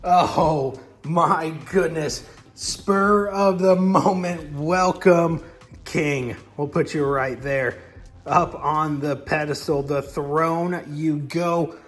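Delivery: crown the king like a grave master of ceremonies; solemn coronation host; solemn ceremony voice. Acted.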